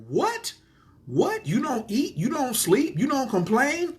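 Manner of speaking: high, rising astonished questions; squeaked-up delighted incredulity; amazed disbelief. Retold or imitated. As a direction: voice shoots up on each question.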